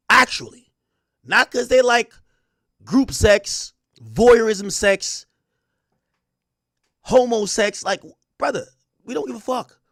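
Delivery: loud, punched ranting list; loud frustrated rant at the media; heated rant. Real, not acted. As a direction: hammer each item loud and angry. Heated rant about exposing kinks.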